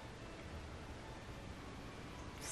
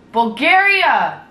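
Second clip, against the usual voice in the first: slow, loud, high mock plea; 5 semitones higher, 6 dB louder, much slower than usual. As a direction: beg the country, hammed up.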